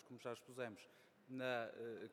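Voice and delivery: ordinary talk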